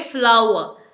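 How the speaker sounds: फ्लावर as FLAU-wa; correct pronunciation. Shown as a demonstration. Teacher equating flower and flour, फ्लावर as FLAU-wa. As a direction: say फ्लावर with w and silent r. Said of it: "लेकिन pronunciation same है".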